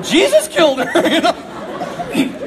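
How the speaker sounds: normal talking voice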